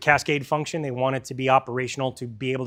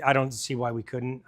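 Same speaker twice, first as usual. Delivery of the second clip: normal talking voice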